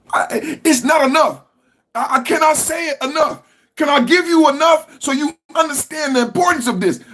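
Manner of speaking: can't contain it, it bursts out; overwhelmed outburst. Real, not acted. To his viewers about Trump, overwhelmed and shouting. Overcome while praising Trump's work.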